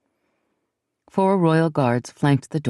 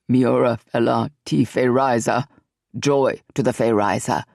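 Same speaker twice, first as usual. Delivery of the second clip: lightly voiced cry; 4 semitones lower than usual. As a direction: lead the chant with a low voice. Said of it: "He glanced at his Fey brethren and cried".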